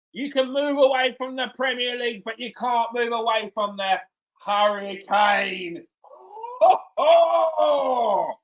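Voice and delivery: high, slow, hammed-up commentary build; mock commentator call. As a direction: ham up a TV goal call.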